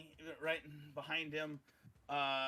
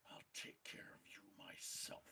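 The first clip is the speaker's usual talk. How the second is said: whispered threat, soft and cold; villain whispering a promise; menacing whisper; 11 dB quieter than usual. Acted. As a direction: breathe the threat close. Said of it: "he goes".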